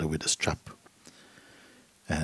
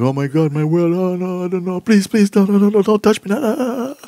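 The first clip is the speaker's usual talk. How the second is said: much higher, louder, pleading panic; acted panic; 12 semitones higher, 9 dB louder than usual. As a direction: beg frantically like someone terrified. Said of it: "then you think".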